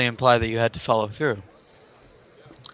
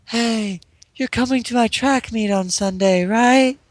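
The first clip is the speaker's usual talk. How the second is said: high, dreamy voice from the past; flashback voice; 8 semitones higher than usual. Pretend. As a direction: voice a hazy memory, pitched up. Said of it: "I'm doing flashback voice".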